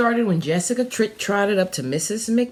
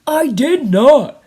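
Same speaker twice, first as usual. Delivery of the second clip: put-on higher, much louder child denying it; indignant child; 4 semitones higher, 10 dB louder than usual. A narrator's reading. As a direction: protest loudly like a wronged kid.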